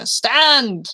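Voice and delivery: a constable spotting a fugitive on the rooftop; shouted order. Acted. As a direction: stop them dead with one shouted word. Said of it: "somebody points at you and says".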